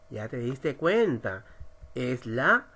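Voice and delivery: ordinary talk, nothing marked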